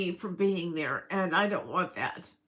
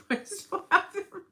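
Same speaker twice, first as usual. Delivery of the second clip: high, laughing repeat; 11 semitones higher than usual. Real, not acted. Woman admitting she still has hormones, laughing. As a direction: say it through a real giggle.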